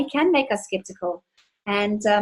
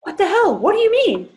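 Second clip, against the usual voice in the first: high, louder disbelieving cry; husband blurting in stunned outrage; shocked outburst; 9 semitones higher, 4 dB louder than usual. Retold or imitated. Husband confronted with pool money, high shocked outburst. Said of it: "And he was like".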